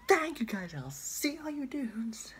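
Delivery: very high loud Irish outro; Jacksepticeye impression. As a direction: shout the goodbye way up high. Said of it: "I can't do that".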